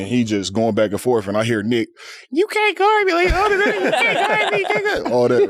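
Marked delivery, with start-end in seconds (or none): 2.3-5.1 very high hammed-up taunt; mock trash talk. Nick trash talking Kobe at practice, squeaky mock taunt.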